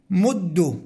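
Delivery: "مد" ending in "u", muddu; damma ending. Shown as a demonstration. Teacher giving students the imperative, "مد" with damma. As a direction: say "مد" alone with doubled d and "u". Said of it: "نفس هذه الأحكام تجري في الأمر".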